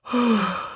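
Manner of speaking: soft, tired sigh trailing off; weary sigh. Acted. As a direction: let the sigh sink away. Lazy neighbour sighs again.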